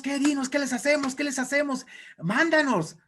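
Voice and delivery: high, pleading henchmen voice; eager demon minions. Acted. Demons beg Satan to send them, high urgent voice. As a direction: play underlings begging the boss for orders. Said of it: "llegó todo su séquito de demonios".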